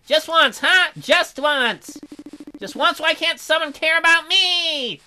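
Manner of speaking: high, loud pleading bear; loud plea. Acted. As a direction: beg the world for once. Bear begs tearfully.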